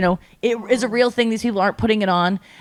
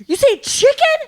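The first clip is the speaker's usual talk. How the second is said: much higher, louder "You say chicken?"; incredulous question; 4 dB louder than usual. Retold or imitated. Friend checking what he heard, high and disbelieving. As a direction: ask it in shocked disbelief. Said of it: "And he was like".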